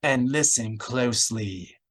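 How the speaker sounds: slow, menacing bite; a slow cold warning; bitten out. A narrator's reading. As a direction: spit it out slowly, coldly. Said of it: "she bit out".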